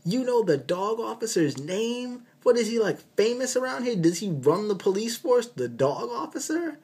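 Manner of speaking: very high, mocking disbelief; incredulous what. Doctor to the attending about the dog, shrill incredulous squeak. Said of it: "it's like".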